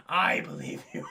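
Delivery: low hero voice, put on; Batman. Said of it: "Batman's like".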